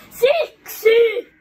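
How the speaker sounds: loud, high yell of "because"; exasperated shout. Real, not acted. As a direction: shout it to end the argument. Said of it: "Huuda tähän".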